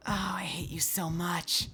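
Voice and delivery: unvoiced soft whisper. Real, not acted. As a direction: breathe it out in a whisper. Whispered after scare.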